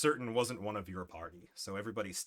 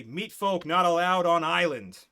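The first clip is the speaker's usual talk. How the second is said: loud, slow, higher broken speech of a tiny guard; little creature guard; 7 semitones higher, 12 dB louder than usual. Acted. Two-foot spearman warning the goblins off, loud slow broken speech. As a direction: bark the rule out slowly and loud. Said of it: "And he say".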